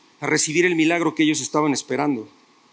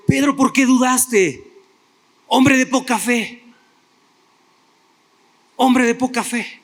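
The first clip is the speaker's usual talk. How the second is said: Jesus rebuking Pedro; 5 semitones higher than usual. Acted. Jesus scolds Pedro after rescue.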